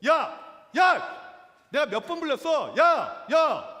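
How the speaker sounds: loud, high, explosive yells; angry shouting. Retold or imitated. Angry diner to a restaurant employee, furious mock shouting. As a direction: yell at staff who keep ignoring you. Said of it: "종업원에게 계속해서 소리를 지르고 있습니다".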